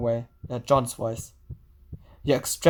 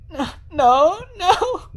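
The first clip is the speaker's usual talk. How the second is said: high, crying no rising to a wail; breaking down; 14 semitones higher, 4 dB louder, much slower than usual. Acted. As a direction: lose control completely. Senri losing control.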